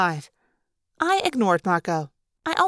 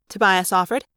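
plain reading voice

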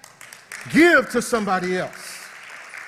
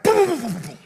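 turbulence booms; 6 semitones higher, 5 dB louder, faster than usual. Retold or imitated. Imitating plane turbulence.

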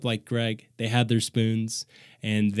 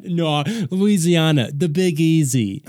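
laughing mock drawl; 5 semitones higher, 4 dB louder, slower than usual. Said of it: "mocking his New Orleans claim".

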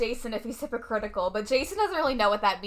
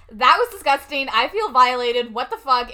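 grossed out; 7 dB louder than usual. Retold or imitated. Reacting to hand kiss.

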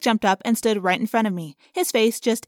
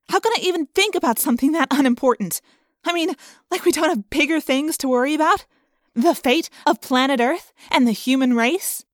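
higher-pitched, incredulous questions; exasperated protest; 6 semitones higher, 4 dB louder than usual. A narrator's reading. Rachel protesting there are bigger worries, exasperated. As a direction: throw up your hands in disbelief.

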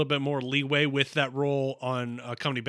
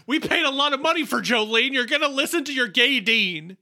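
high-pitched, hammed-up insistence; mock demanding producer; 8 semitones higher than usual. Co-host jokingly demands listeners hear the pricey song, mock insistent. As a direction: push it out high in mock indignation.

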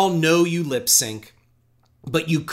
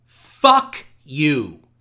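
louder shouted reading of Fuck you; read as all caps; 7 dB louder than usual. Acted. Podcaster reading a hate letter aloud, loud mock shout. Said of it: "And that is in caps".

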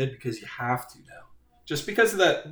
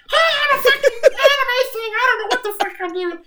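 high, loud squeaky anime squeal; mock anime voice; 7 dB louder than usual. Friend mocking a bad anime impression on Twitter, high squeal. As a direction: squeal it like a cartoon anime kid. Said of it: "didn't even do Zin's voice".

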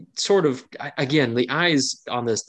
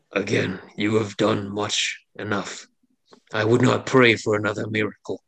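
mild chuckling between sobs; grotesque monster voice. Acted. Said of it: "sort of chuckling tones between sobs".

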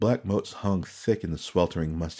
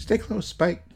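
a higher, lightly voiced young woman, murmuring; Suki's murmur; 13 semitones higher, 4 dB louder, slower than usual. A narrator's reading. Young woman urging her ratling to stay near, higher murmur. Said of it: "Asako Suki murmured".

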